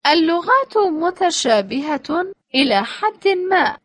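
normal talking voice

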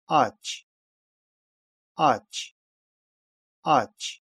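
"ач" ending in a voiceless "ч"; "ч" at word end. Shown as a demonstration. Teacher giving an example for learners, final "ч" in "ач". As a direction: say "ач" with a crisp final ch. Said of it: "иногда произносится «ч»".

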